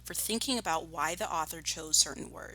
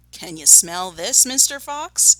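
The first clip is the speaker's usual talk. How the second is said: loud taunting call, rising at the end; shouted; 10 dB louder than usual. A narrator's reading. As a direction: call it out loudly down the hole. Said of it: "he shouted".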